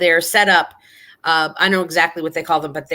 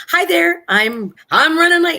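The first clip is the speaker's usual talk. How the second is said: bright, much higher and louder hurried hello; breezy greeting; 12 semitones higher, 4 dB louder than usual. Retold or imitated. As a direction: chirp it while rushing past. Retells greeting stranger while rushing.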